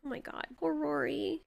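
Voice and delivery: quiet, heartbroken murmur; soft pitying sigh. Real, not acted. Pitying a doomed movie character.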